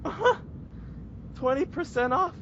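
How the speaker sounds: high-pitched imitation of a delighted customer; overjoyed disbelief. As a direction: gasp the question like a customer about to cry. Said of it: "she's about to fucking cry".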